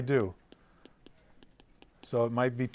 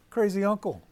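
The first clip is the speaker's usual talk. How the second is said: put-on higher, excited girl's voice; niece voice; 8 semitones higher, 4 dB louder than usual. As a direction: play the eager eleven-year-old niece.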